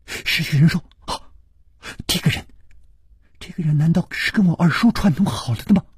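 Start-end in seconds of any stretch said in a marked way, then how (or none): 1.1-5.8 shocked question. A narrator's reading. Girl shocked by possible plot.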